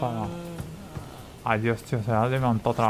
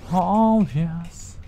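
loud mock English cheer; 4 semitones higher, 3 dB louder than usual.

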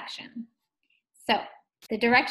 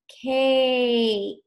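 CAKE with the long A held out; stretched vowel; 5 dB louder than usual. Shown as a demonstration. Teacher showing the long A sound, CAKE stretched slowly. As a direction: draw out the vowel in CAKE, loud and clear.

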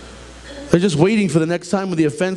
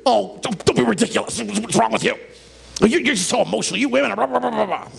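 dismissive husband; 5 semitones higher, faster than usual. Retold or imitated. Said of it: "what the men do".

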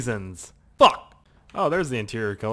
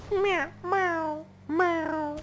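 high cartoon snail meow; Gary meow; much slower than usual. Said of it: "a really good Gary meow".

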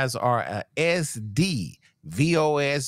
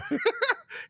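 high-pitched burst of laughter; 4 dB quieter than usual. Real, not acted. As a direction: squeal of laughter at a friend's story. Laughing at friend's injury.

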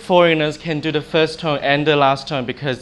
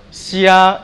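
"xia" held high and level; flat tone; 4 semitones higher, 4 dB louder than usual. Shown as a demonstration. Teacher showing the flat tone, "xia" held level. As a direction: sustain "xia" on one steady raised pitch. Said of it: "a is a flat tone".

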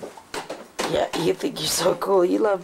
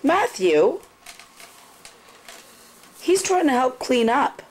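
higher, raised call of his name; reprimanding; 7 semitones higher than usual. Real, not acted. Mother pulls son up for being mean to his brother, reprimanding.

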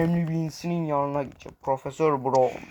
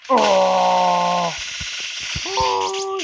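battle scream; 3 semitones higher than usual. Pretend. Battle cry while attacking.

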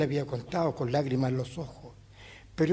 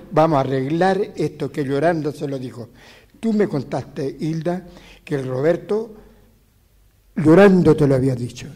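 raised insistent voice, louder; loud retold demand; 9 dB louder than usual. Old man retelling a friend's loud demand, louder. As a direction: press the point loudly in the retelling.

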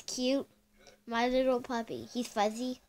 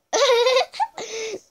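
real laugh; 12 semitones higher, 10 dB louder than usual. Real, not acted. Cracking up at the Kit Kat commercial, a loud laugh. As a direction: burst of high, loud laughter.

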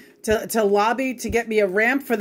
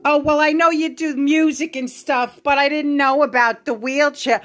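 higher, airy mock of the mother's excuse; 6 semitones higher than usual. Daughter mocking her mother's lame excuse. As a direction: parrot her flimsy excuse. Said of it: "You can imagine the bigger things".

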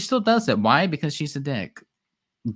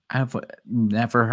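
usual speaking voice

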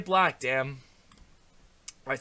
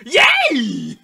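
loud, high, drawn-out squeal; gleeful cheer; 8 semitones higher, 21 dB louder than usual. Pretend. Player celebrating the makeover, gleeful cheer. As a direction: let out a big shouted cheer.

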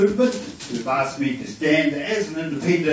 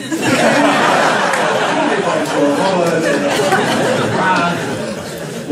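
laugh; 4 dB louder than usual. Real, not acted. Laughs at own joke.

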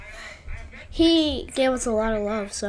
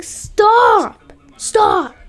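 mock yell; 8 semitones higher, 6 dB louder than usual. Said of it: "And he's like".

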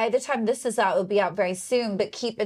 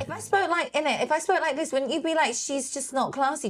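higher-pitched put-on Essex accent; unclassy Essex-style accent; 5 semitones higher than usual. Shown as a demonstration. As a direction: demonstrate a common Essex "innit" accent. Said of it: "That's another example of an accent".

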